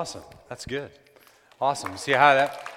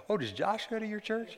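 curious churchgoer; 8 semitones higher than usual. Retold or imitated. Imitating people asking about Josh.

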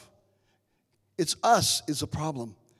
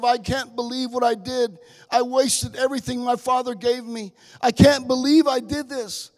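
anguished son; 6 semitones higher, 5 dB louder than usual. Acted. Son's guilty inner voice voiced.

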